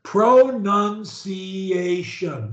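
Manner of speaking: PRONUNCIATION very slowly, every syllable drawn out. Shown as a demonstration. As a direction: model PRONUNCIATION syllable by syllable for a learner. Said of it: "say it slower".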